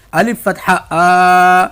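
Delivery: آ stretched long in الف فتحہ آ; drawn-out vowel. Shown as a demonstration. Teacher drawing out آ for students. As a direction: drag out the آ slowly for practice. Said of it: "ابھی ہم تھوڑا کھینچ رہے ہیں".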